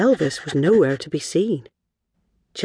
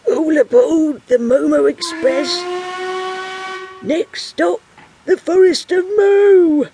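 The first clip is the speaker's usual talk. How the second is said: high, drawn-out boarding call; conductor's shout; 5 dB louder than usual. Acted. Oldest man ever, a conductor, calling passengers aboard, shouting. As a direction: call out to the whole platform. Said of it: "shouted out".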